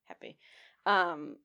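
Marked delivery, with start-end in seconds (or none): 0.1-0.4 breathy happy whisper; soft whispered contentment. Real, not acted. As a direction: hush it like a secret joy. Blissful aside in her own booth.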